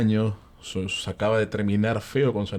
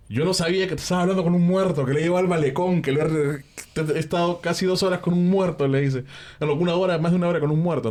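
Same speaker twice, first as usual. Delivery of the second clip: high, agitated disbelief; retold shock; 8 semitones higher than usual. Taxi driver realising his passenger was dead, retold shock. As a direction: act out spooked astonishment, pitched well up.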